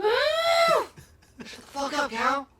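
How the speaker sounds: high sharp yell at the cow. Pretend. Talking to a baby cow, high mock yell. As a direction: shout the cow down.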